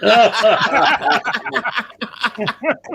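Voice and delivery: high-pitched burst of real laughter; hearty laugh. Real, not acted. Friend reacting to a joke, hearty high laugh. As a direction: crack up at the joke.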